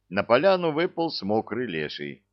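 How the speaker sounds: usual speaking voice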